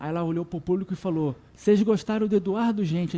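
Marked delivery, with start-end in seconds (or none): 1.6-3.2 loud, high call out; calling to the crowd. Retold or imitated. [name] asks the crowd.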